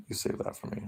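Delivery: a creepy little whispered tease; whispered mock-intimate aside to the chat; teasing whisper.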